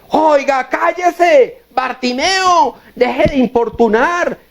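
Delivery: high-pitched mock scolding, names drawn out; scolding crowd. Bystanders telling the blind beggar to stop shouting, acted high scolding. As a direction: act out an annoyed crowd hushing someone, pitched well up. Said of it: "Los que estaban regañándolo".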